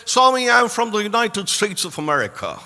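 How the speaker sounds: imitated American accent, rolling through the words. Boy answering Swami where he is from, in an American accent. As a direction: put on an American accent for the reply. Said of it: "in an American accent".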